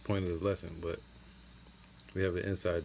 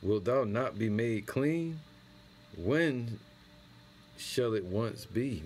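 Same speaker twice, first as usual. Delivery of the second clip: scripture reading; 3 semitones higher, much slower than usual. Acted. Reads prophet's lament slowly.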